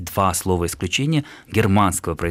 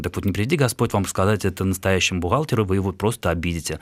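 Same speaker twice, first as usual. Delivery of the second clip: ordinary talk, nothing marked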